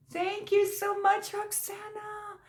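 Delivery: high, tender gratitude; gushing thanks. Real, not acted. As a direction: sing out your thanks. Thanking the sender.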